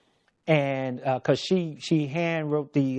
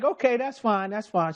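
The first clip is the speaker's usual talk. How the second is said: nurse voice, higher; 8 semitones higher, faster than usual. Retold or imitated. Nurse reassuring him.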